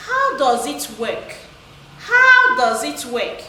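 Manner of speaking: wh-question with the voice dropping on "work"; falling tone. Shown as a demonstration. Teacher gives pupils a textbook wh-question, falling tone on "work". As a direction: start up on "How", let "work" fall at the end. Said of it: "you use the word falling tone".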